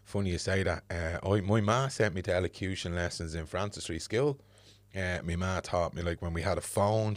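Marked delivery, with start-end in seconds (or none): none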